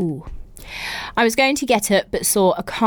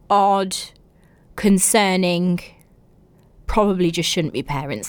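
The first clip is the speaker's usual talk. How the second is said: usual speaking voice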